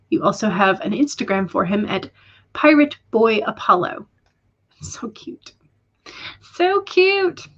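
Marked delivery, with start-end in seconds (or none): 4.8-5.5 whispered, barely voiced fondness; hushed aww. Real, not acted. Streamer melting over a viewer's one-eyed cat, hushed coo. 6.4-7.5 squeaky-high gushing over a pet; high-pitched coo. Real, not acted. Streamer gushing over a viewer's pirate cat, high coo.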